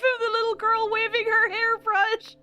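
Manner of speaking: talking through giggles, high; laughing so hard the voice climbs. Real, not acted. Laughing while explaining the gif.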